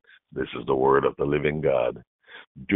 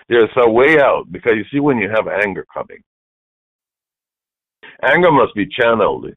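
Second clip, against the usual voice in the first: preaching louder; 5 semitones higher, 5 dB louder than usual. Acted. Preacher building intensity.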